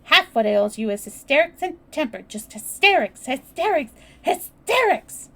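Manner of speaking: loud rant climbing in pitch; escalating angry outburst; shouted scolding. A narrator's reading. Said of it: "shouted Mary".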